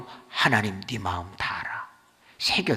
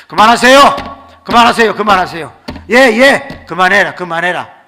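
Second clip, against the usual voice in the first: loud repeated calls to stop; alarmed commands; 5 semitones higher, 16 dB louder than usual. Retold or imitated. Pastor stopping the prayer meeting, urgent shouting. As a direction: shout to halt everyone, then ease off.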